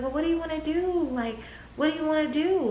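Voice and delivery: high, soft imitation of her friend; friend's voice. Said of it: "My friend was like".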